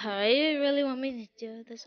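normal talking voice

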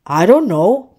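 mild storybook shrug; shrugging. A narrator's reading. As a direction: say it offhand, without shouting. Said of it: "Puffy shrugged".

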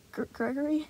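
timid stuttered name; stammering. Acted. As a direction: stammer your own name. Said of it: "he stammered".

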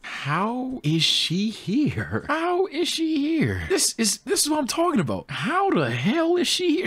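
can't believe she's back; incredulous yelp; stunned disbelief. Real, not acted. Streamer stunned by Izzy's return.

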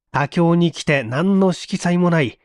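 ordinary delivery, nothing marked

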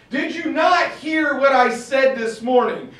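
loud, high, shouted demand; furious shout. Retold or imitated. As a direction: yell it at him in a rage. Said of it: "he blows his stack".

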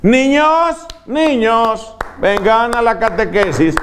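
put-on high, sing-song nun calling the children; mock nun summoning children to catechism; imitating the nun. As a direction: call the kids in like a sugary old nun. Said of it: "Esa era toda la evangelización de la hermana".

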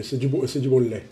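lightly voiced nod to a fellow streamer; a hint of someone else's voice; Smiley's catchphrase. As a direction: toss off the catchphrase in a friend's manner. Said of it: "comme dirait notre ami Smiley".

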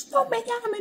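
hammed-up frazzled aunt begging not to be called; panicked aunt. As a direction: squeak it in a mock panic.

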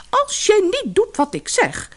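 high, sharp angry threat; wife scolding the fisherman angrily. Acted. Angry wife threatens her husband, high and sharp. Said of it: "zei de vrouw boos".

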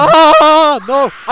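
high, loud scream of panic; krzyk paniki. Real, not acted. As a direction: scream as you get killed in a game. Character being killed in game.

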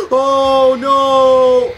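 gasp, then a long loud high wail; real scream of dread, drawn out; terrified yell. Real, not acted. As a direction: cry out in sheer fright. Monster jump scare.

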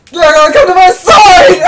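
panicking gamer. Real, not acted. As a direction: yell at the screen in a panic. Yelling at the game.

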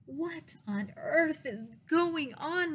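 high, loud, slow disbelief; drawn-out dismayed question; exasperated cry. A narrator's reading. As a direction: cry out as a baffled mom. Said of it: "my mom cried".

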